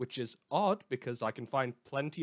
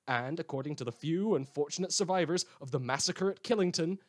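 measured news-anchor delivery; dry radio newscaster tone; news read; 4 semitones higher than usual. Acted. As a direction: read the bulletin like an evening anchor. Reads fictional news bulletin.

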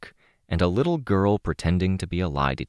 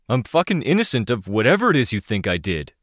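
fast indignant denial; 6 semitones higher, 3 dB louder, faster than usual. Acted. Mercenary denies under pressure.

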